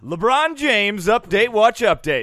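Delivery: loud slow segment announcer. Acted.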